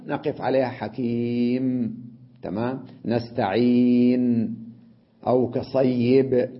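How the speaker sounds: slow, each final letter stopped dead with no vowel: حكيم، نستعين، كصيب; stop on a sukun. Shown as a demonstration. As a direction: end نستعين and كصيب on a bare silent consonant. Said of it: "هكذا نقف السكون". (وقف بالسكون على حكيم ونستعين وكصيب ببطء)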